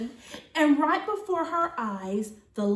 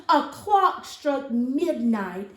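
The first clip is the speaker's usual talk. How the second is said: plain reading voice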